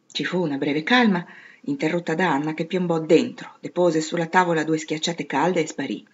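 ordinary delivery

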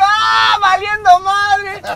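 quejido agudo, fuerte y alargado, exagerado; lamento de susto. Retold or imitated. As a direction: lamento cómico de quien se sabe perdido. Recrea su susto ante el pleito. (grito lastimero actuado, arrastrando las palabras)

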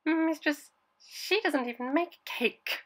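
high, slow mocking tone. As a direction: drag it out to mock her.

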